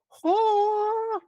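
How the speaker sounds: put-on high, loud childish shriek; child's shout. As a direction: bark out a kid's startled yelp. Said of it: "as a child".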